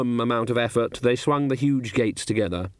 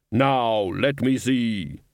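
very slow, drawn-out Death voice; much slower than usual. A narrator's reading. Death demanding the Dean's clothes, in a very slow, commanding voice. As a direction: stretch each word out with solemn command.

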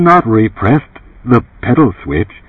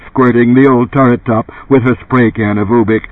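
ordinary delivery

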